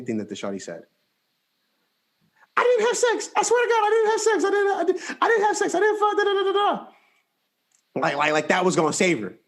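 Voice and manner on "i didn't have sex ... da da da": high, fast, laughing denial; panicked girl voice. Retold or imitated. Girl denying everything to her mom, high and frantic. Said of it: "mama starts yelling".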